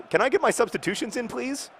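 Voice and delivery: mock frustrated football manager; imitating a whiny manager. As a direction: play a manager begging for his substitutions. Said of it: "I was watching an actual".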